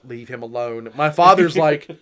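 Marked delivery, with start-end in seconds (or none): 0.0-0.9 much softer, lower mock wife's rebuke; hushed scolding. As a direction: hiss it under your breath like a wife. Wife scolding him quietly.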